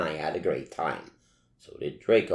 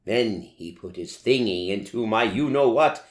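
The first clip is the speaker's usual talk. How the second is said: plain reading voice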